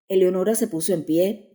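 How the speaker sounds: ordinary delivery, nothing marked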